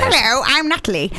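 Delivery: lightly voiced old hag; a hint of a croaky hag. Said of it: "Why does Nat speak like a hag".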